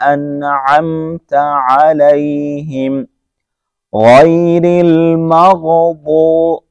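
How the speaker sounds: slow, melodic tajweed recitation; Quran recitation. Shown as a demonstration. Teacher reciting to students, slow melodic recitation. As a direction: chant each word slowly and evenly.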